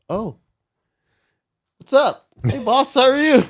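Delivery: high, loud flustered hello; awkward greeting. Retold or imitated. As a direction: squeak out a nervous hi boss.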